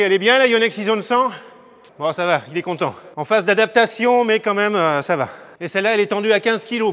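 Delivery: calling out. Real, not acted. Calling across the court.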